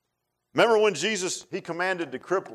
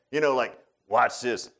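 ordinary talk

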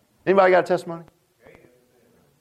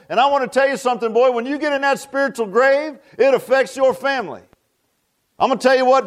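insistent, rhythmic sermon delivery; fervent preaching; 4 semitones higher than usual. Acted. Preacher warning parents their spiritual grave affects family, fervent preaching. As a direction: drive each phrase home to the congregation.

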